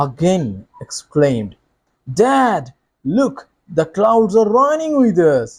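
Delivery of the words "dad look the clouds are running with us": excited exclamation. Shown as a demonstration. The boy excitedly tells his father the clouds are racing them. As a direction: cry out in delight at the clouds. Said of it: "সে আবারও excited".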